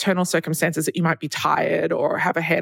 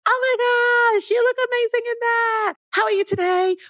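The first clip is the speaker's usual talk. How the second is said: perky sales assistant. Retold or imitated. Sales assistant gushing at a shopper in a high perky voice. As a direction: squeal it at a customer like an overeager sales assistant. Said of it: "Why are you screeching in the high-pitched voice?".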